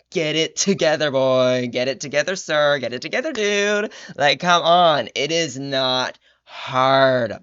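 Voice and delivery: loud, fed-up scolding, over and over; exasperated yelling. Retold or imitated. Vlogger yelling at the author to get it together, loud and exasperated. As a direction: yell it like you've lost all patience. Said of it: "And I was just like".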